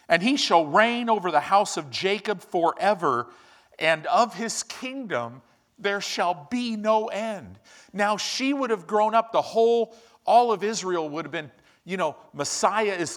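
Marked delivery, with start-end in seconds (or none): 0.1-7.6 scripture reading. Acted. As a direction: proclaim it like the angel's announcement. Reading Luke aloud.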